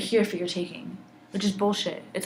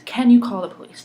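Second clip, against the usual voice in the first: much louder, urgent repeat of the question; insistent retold plea; 3 semitones higher, 10 dB louder than usual. Bystander asking a driver for help, loud and insistent. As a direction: press the request hard, raising your voice.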